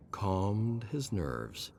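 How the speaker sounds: plain reading voice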